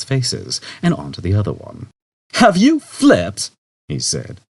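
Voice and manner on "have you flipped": higher, louder, slow disbelief; incredulous Zaphod. A narrator's reading. Zaphod to the computer, incredulous. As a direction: ask if it's gone mad.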